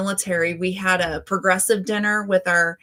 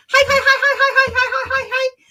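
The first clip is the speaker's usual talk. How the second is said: excited hi; 6 dB louder, faster than usual. Real, not acted. Greeting viewer Xander on livestream.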